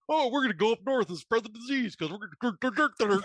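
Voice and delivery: high, sneering mock voice of careless people; laughing parody of selfish rule-breakers; mocking selfish campers. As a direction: lampoon them as smug idiots while laughing. Mocking reckless campers from Michigan.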